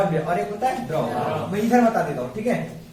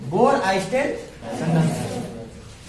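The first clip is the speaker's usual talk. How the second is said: slow, spelled-out term; slower than usual. Shown as a demonstration. Teacher stresses a hard term.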